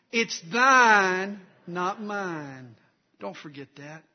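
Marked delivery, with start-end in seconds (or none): 0.1-2.8 big Southern "thine", raised and loud; Southern accent. Shown as a demonstration. Preacher leading the congregation to say it with him, Southern accent. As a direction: lead the chant in a Southern accent. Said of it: "say that with a Southern accent".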